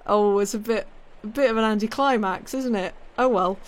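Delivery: lightly voiced mock letdown; mock disappointment. Streamer mocking the Bastille storming, mildly let down. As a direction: pretend to be underwhelmed. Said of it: "I was like".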